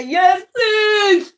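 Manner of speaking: very high, stretched-out begging; exaggerated high-pitched submission; mock pleading. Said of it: "You get up and say".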